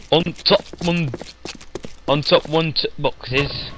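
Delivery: put-on on top one in a northern English accent. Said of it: "That's my northern accent for you".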